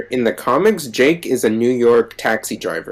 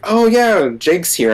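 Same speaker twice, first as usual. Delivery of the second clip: mock announcement; 8 semitones higher, 4 dB louder than usual. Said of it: "when they were like".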